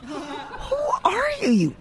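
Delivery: mock the caller while laughing; laughing mock question.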